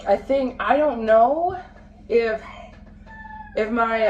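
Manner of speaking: halting and high, holding back crying; real near tears. Real, not acted. Vlogger wonders about grieving siblings, tearful and halting. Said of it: "Now I wanna cry".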